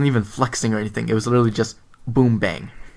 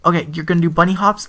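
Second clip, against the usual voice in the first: put-on young sensei; 5 semitones higher, faster than usual. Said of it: "and he's like".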